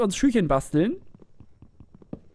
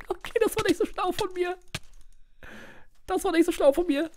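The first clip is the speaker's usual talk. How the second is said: laughing self-mockery; 11 semitones higher, 4 dB quieter than usual. Real, not acted.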